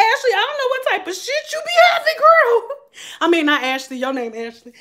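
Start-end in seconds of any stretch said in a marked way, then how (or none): 0.1-2.8 high, indignant retold outburst; high-pitched complaint. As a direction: pitch it way up in mock exasperation.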